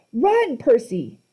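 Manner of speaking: urgent call. A narrator's reading. Annabeth calling out.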